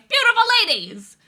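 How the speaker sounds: high, loud hawking call; hammed-up little Italian restaurant barker; little restaurant tout. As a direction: holler invitingly at passersby. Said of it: "outside of one of these restaurants being like".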